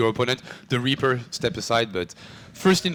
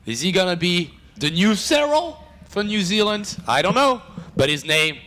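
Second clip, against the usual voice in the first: high, excited match introduction; big esports intro voice; announcer intro; 9 semitones higher than usual. Pretend. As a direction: announce the players in a raised, drawn-out opening call.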